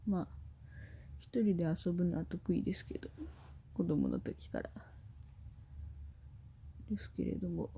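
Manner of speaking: normal talking voice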